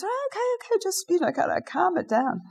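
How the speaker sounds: very high, squeaky mock reply; high-pitched imitated "okay, okay, calm down" protest; flustered placating reply. Acted reply to being yelled at.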